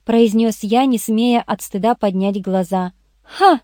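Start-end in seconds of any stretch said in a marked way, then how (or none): 3.2-3.6 short, high-pitched triumphant "ha"; exclamation. A narrator's reading. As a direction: exclaim it suddenly, pitched well up. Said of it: "воскликнула Ирен".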